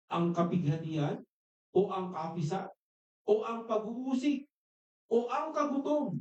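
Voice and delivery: slow, building list of questions; preacher reading Paul's questions, slow and rising; scripture reading. Acted.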